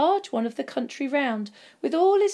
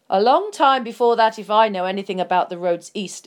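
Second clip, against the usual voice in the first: interrupting; 8 dB louder than usual. A narrator's reading. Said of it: "interrupted Gandalf".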